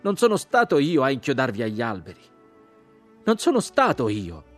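loud, high protest; desperate repeated denial; shouting. A narrator's reading. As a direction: insist at full voice on your innocence.

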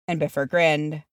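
ordinary delivery, nothing marked

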